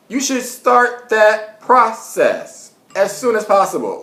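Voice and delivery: slow, high, stressed start; drawn-out insistent advice; emphatic. Real, not acted. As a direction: lean hard on start. Stressing the point to viewers.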